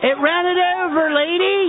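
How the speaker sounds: high-pitched mimicked Philly accent; لكنة فيلادلفيا. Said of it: "hears me doing this accent".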